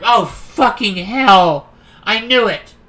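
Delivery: loud, drawn-out curse; exasperated outburst. Real, not acted. Player cursing as the drink goes wrong, loud exasperation. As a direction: burst out loudly, pitch jumping. Startled reaction to game event.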